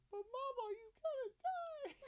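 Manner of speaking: put-on very high, softened small-boy voice; squeaky hushed mock alarm; child impression. Dad quoting his son to wife, soft high child voice.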